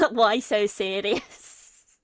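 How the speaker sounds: put-on loud, drawn-out Scouse accent; slow, loud Scouse villain after a laugh; Scouse Joker. Streamer cracking up at own bit, loud slow Scouse Joker. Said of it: "What if the Joker was Scouse?".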